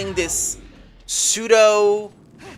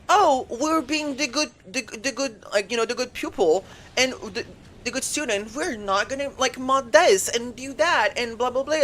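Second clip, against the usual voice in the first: mocking quote; 5 semitones higher, 4 dB quieter than usual. Mocking goody-two-shoes Japan players.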